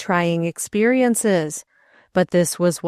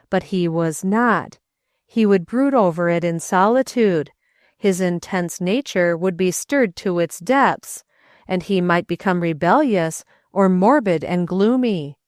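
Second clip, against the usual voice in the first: usual speaking voice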